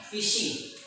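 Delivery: Fishing with a clear sh sound. Shown as a demonstration. Teacher models Fishing's sh for the class. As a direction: stress the sh in Fishing. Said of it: "dibaca shin".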